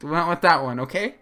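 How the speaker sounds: loud, high, insisting; defensive. Real, not acted. Defending the chibi choice to his friend, loud and insistent. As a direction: protest a little too much.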